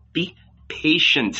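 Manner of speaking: soft breathy whisper; tender whisper. A narrator's reading. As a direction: soothe him in a whisper. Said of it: "spoke to him quietly, tenderly".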